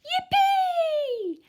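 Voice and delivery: a cheering yippee; excited cry. A narrator's reading. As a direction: whoop with glee. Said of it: "the T's cried out".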